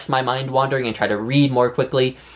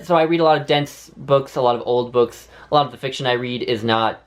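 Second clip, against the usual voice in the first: usual speaking voice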